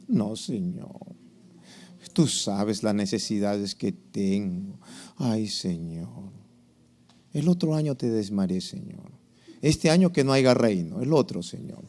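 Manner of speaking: low, soft, slow mock pleading; hammed-up doleful excuses to God; reluctant tither praying. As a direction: beg off mournfully with sighing pauses. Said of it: "Y cuando diezmamos".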